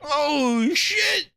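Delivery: ordinary talk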